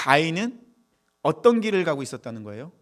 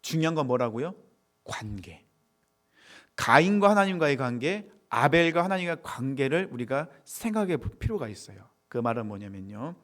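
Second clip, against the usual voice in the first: normal talking voice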